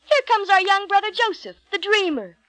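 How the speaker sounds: excited storybook cry. A narrator's reading. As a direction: call it out high, as to brothers nearby. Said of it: "cried one of the brothers".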